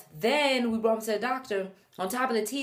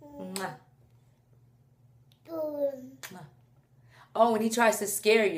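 ordinary talk

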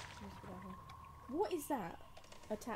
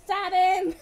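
excited yell; 8 semitones higher, 18 dB louder, much slower than usual. Real, not acted. Yelling at game character.

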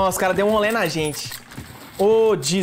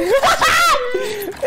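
loud high laugh; 3 dB louder than usual. Real, not acted. After escaping.

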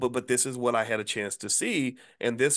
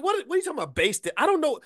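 high confused squeak; 14 semitones higher, faster than usual. Retold or imitated. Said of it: "I'm like".

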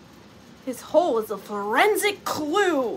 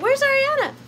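high, loud calling-out question; imagined searcher asking; 12 semitones higher, 7 dB louder than usual. Acted. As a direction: call out as someone looking for her. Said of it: "You'll be like".

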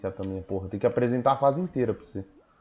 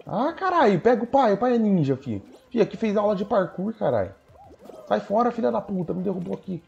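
hyped bragging; 8 semitones higher than usual. Real, not acted. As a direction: brag like you're unstoppable. Boasting mid-parkour stage. (se gabando empolgado, voz alta)

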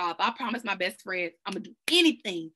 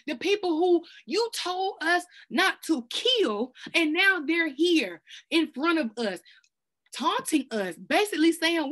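pleading prayer; 5 semitones higher than usual. Acted. Jehoshaphat pleading and retelling taunts.

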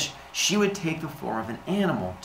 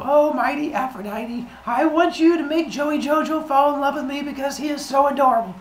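much higher, gushing plea to a goddess; pleading prayer to Aphrodite; 14 semitones higher than usual. Pretend. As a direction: beg the goddess sweetly in a high put-on voice. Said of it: "and you would go".

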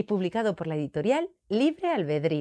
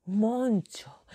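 plain reading voice

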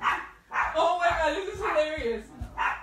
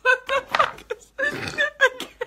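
much higher, squealed through laughter; laughing disbelief; 12 semitones higher than usual. Real, not acted. Seeing the lipstick, she squeals through laughter.

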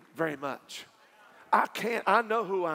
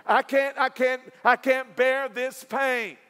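full force preaching; 9 semitones higher, 11 dB louder than usual. Acted. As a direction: lift the whole room as you preach. Preaching climax with music.

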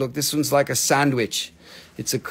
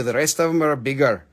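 usual speaking voice

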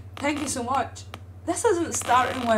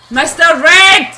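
drawn-out yell of Mister Red, loud and high; slower, bigger shout of the name; 4 semitones higher, 14 dB louder, slower than usual. Real, not acted. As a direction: stretch the name out in a big shout. Calling out during opening clip.

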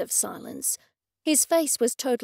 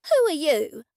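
higher, brusque challenge; rude demand; 4 semitones higher, 4 dB louder than usual. A narrator's reading. Dorothy confronts the gallery owner, rudely. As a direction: demand it rudely, pitch jumping up. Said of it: "she rudely addressed Mortimer".

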